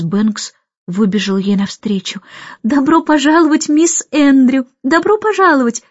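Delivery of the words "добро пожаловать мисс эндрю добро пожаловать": much higher, bright hostess welcome; mother greets the aunt warmly; welcoming. A narrator's reading. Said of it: "приветливо сказала она".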